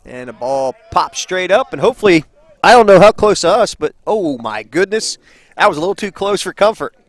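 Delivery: loud, startled play-by-play; rattled announcer after a near miss; real alarm. Real, not acted. Foul ball nearly hits booth.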